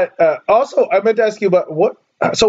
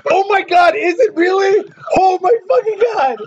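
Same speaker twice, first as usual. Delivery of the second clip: high-pitched, thrilled disbelief; excited disbelief; 13 semitones higher than usual. Real, not acted. Guesser thinks they nailed the name, excited disbelief. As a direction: squeal it, voice shooting way up.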